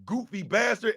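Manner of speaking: ordinary delivery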